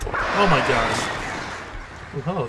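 usual speaking voice

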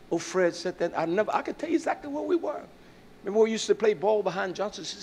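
ordinary delivery

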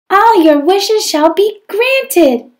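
high, loud, slow fairy cry; a dirty fairy bursting out with a grand announcement. Acted. Teacher reading the fairy casting her spell, high and loud. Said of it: "cried the fairy".

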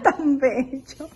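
slow, laughing and charmed. Real, not acted. Mom charmed by son.

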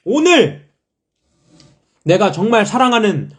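high, loud and thrilled; bursting with excitement; excited. Real, not acted. Excited announcement of group's win.

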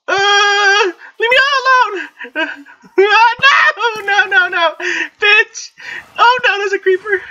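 high, loud pleading squeals; panicked shrieking. Real, not acted. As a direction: shriek to be left alone as monsters close in. Chased by monsters.

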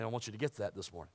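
normal talking voice

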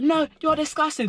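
high imitation of her angry retort; high mock outrage; mock indignant. Retold quarrel, her voice.

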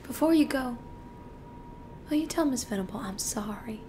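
soft, halting, near-whispered thanks; hushed and humbly grateful, with long hesitant pauses; meek gratitude. Acted. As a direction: speak timidly, barely above a whisper. Grateful client thanks his lawyer.

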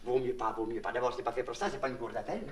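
low, soft cautioning; hushed warning. Acted. Warning a prisoner after an outburst, hushed and low. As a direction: drop right down, as if guards might hear. Warns prisoner not to shout.

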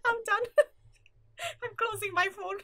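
laughing. Real, not acted. Laughing at own jokes.

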